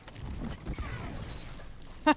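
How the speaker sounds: silent, airless high-pitched laugh; high wheezy laugh. Real, not acted. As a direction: wheeze with laughter, barely voiced. Laughing at another player's misplay.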